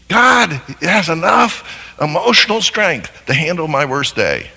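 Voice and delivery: ordinary talk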